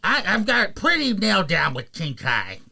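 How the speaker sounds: odd high-pitched teacher character; King Kai. Acted. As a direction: boast in a quirky cartoon voice. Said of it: "You do a great King Kai".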